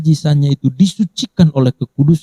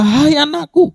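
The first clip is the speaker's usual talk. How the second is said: much higher, ringing call to a daughter; proclaiming call; slower than usual. Acted. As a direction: lift it up like a joyful proclamation. Jesus voiced calling the woman.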